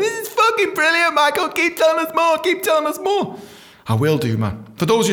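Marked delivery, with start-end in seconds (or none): 0.0-3.4 high, eager fan voice; very high mock fan voice; fans cheering him on. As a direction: gush like a devoted listener.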